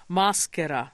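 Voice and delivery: "maschera" clearly sounded out, ma stressed; careful Italian "maschera"; slow pronunciation demo. Shown as a demonstration. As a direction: model "maschera" so a learner gets it. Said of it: "I'm doing it slow".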